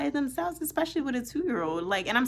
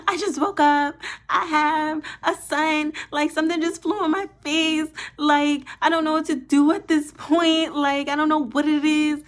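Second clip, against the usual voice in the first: retold panic; 7 semitones higher, 6 dB louder than usual. Panicked call to emergency line.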